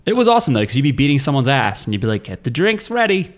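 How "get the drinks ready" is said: much higher imitation of a Greek game fighter; Greek grappler character. Quoting a favorite fighter mid-beating, imitated Greek character voice. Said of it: "full Greek voice acting for my favorite character".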